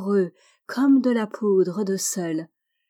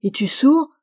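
exasperated question; 5 semitones higher, 6 dB louder than usual. A narrator's reading. Old man questions unanswering child.

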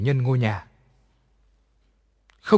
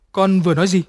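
louder, higher startled ask; frightened mother-in-law asks what she said; startled question; 5 semitones higher, 6 dB louder, faster than usual. A narrator's reading. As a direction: jolt of alarm. Startled old woman asks.

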